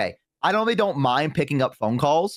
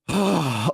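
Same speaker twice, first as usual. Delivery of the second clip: heavy exasperated sigh, acted; imitated sigh; 2 semitones higher than usual. Annoyed clerk after third repeat request, imitated sigh. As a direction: let out a long audible breath. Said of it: "like, sigh, like that".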